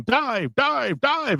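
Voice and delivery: mock Russian sub commander barking dive; high mock Russian dive call; Russian accent. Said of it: "in a Russian accent".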